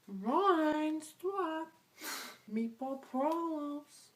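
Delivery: high and loud hammed-up Jersey girl; mock Jersey girl. Imitating fans telling Ryan to stop, high hammed-up squeal. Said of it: "they're gonna be like".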